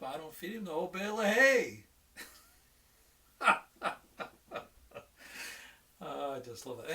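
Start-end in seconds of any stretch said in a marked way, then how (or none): 0.0-1.8 punchline burst. Retold or imitated. Farmer's punchline in told joke. 3.4-5.8 a big, high delighted laugh at his own joke; hearty laugh. Real, not acted. Teacher cracking up at his own farmer joke, hearty laugh.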